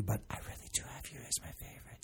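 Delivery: secret whisper. Real, not acted. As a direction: hush it like a secret. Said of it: "Shh, don't tell anybody".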